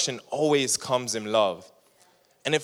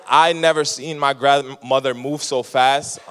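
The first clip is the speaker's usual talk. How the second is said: ordinary talk, nothing marked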